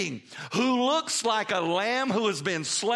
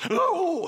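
crying out; 10 semitones higher, 4 dB louder than usual. Retold or imitated. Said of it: "here I go again".

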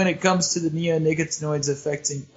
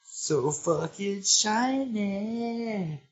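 dreamy sing-song; 5 semitones higher, much slower than usual. Pretend. Mocking the butterfly boast.

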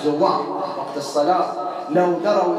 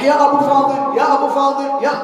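high, raised repeated cry; repeated calling; 9 semitones higher, 5 dB louder than usual. Retold or imitated. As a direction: echo the patient calling out. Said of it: "يكررها". (تكرار النداء بصوت مرتفع)

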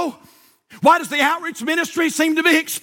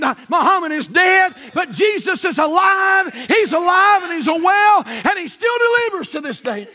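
fervent preacher's rhythm with shouted peaks; preaching at full force; 4 semitones higher than usual. Acted. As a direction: drive it home to the congregation.

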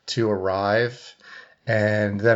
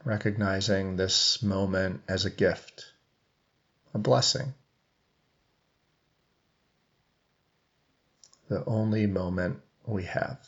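even meditation voice with long silences; guided meditation. Real, not acted. Coach leading seated listeners into breath awareness, guided meditation. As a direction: guide them gently, leaving long pauses between phrases.

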